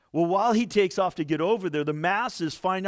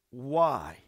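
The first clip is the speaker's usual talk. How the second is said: "why" set apart, lower and drawn out; stress on why; 6 semitones lower than usual. Shown as a demonstration. Preacher singles out the word for the congregation, "why" stressed. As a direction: make "why" land on its own. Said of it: "do you catch that word".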